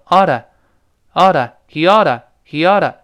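slow model of "he oughta", t flapped; linked pronunciation. Shown as a demonstration. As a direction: punch the AH in "oughta", repeated for learners. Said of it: "是这么一个声音啊".

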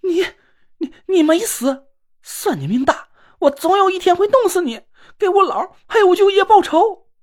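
put-on girl screaming at the man she blames; shrill scream. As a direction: shriek vengeance through tears. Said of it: "尖声大叫了起来".

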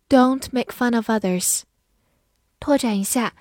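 normal talking voice

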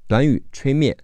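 normal talking voice

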